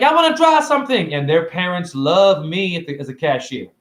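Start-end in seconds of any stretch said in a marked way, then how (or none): 0.0-1.1 put-on high, eager little kid; child's voice. A kid at his store asking the cashier, high and eager. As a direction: pipe it up like an excited child.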